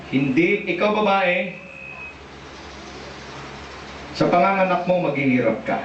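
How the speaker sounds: low, measured voice of God; the Lord pronouncing judgement. Acted. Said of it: "At ang sabi ng Lord". (mababang boses ng Diyos na humahatol)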